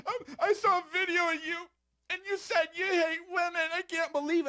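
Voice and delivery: high, put-on voice of a scandalised viewer; mock outraged viewer. Mocking future viewers who clip his audio, high mock outrage. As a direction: squeal it like a shocked gossip. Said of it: "people are like".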